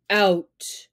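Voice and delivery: snapped. Acted. Father Wolf snaps at the jackal to leave. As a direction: bark him out of the cave. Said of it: "Father snapped".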